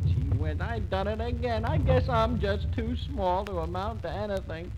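put-on high, crestfallen little boy; small boy lamenting his mishap in a high voice; Chicken Nose.

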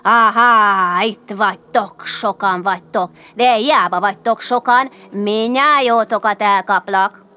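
loud gloating threat; sneering rope creature, forceful; mocking hiss. A narrator's reading. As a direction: taunt the victims, stretching the last word. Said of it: "Gúnyosan ezt sziszegte".

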